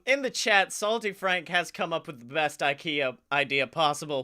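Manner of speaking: usual speaking voice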